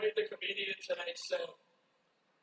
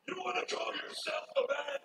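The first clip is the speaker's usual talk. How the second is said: taunting; 9 semitones higher than usual. Acted. Challenge in wrestling promo.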